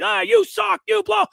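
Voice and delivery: high, loud jeering taunt; mock troll insult. Host imitating a social media troll jeering at him, mock taunt. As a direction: sneer it like an online heckler.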